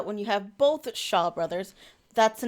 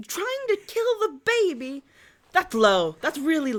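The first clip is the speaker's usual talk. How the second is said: much higher, incredulous mock outrage; mock indignation; 5 semitones higher than usual. Hero confronts the baby's attacker, mock indignation. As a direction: squeal the accusation in disbelief.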